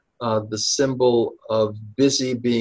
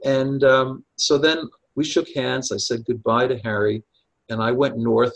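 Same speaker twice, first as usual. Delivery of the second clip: ordinary talk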